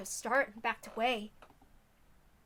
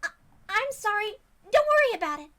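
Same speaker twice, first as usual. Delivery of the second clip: put-on much higher, gentle worried girl voice; worried girl Rena; 11 semitones higher, 10 dB louder than usual. Rena checking on Keiichi after he collapsed, worried.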